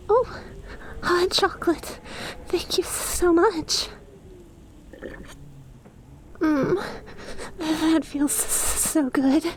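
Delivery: light up at the sight of the cup; squealing with joy over hot chocolate; delighted. Acted.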